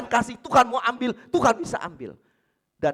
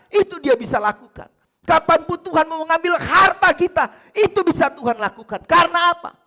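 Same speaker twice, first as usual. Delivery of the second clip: loud, higher preaching voice; full-force preaching; 11 semitones higher, 11 dB louder than usual. Acted. Pastor preaching loudly to the congregation. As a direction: drive the point home to the congregation.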